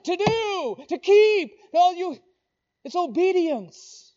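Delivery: high, loud, urgent preacher's voice; full-force preaching. Real, not acted. Preacher urging people to observe God's word, full-force preaching. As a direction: drive each phrase up with rising urgency.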